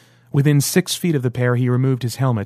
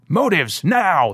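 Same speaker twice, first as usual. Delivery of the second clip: bellowed order; 6 semitones higher, much slower than usual. A narrator's reading. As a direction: demand it like a squad leader. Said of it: "the squad leader bellowed".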